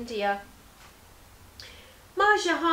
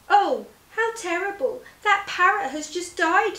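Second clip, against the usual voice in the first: high, louder gasp of horror; shocked dismay; 8 semitones higher, 6 dB louder than usual. Acted. Merchant sees the parrot drop, high and shocked. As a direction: recoil in shock at the falling bird.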